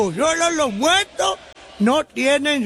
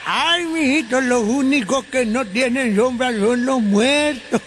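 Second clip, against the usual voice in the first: ordinary talk, nothing marked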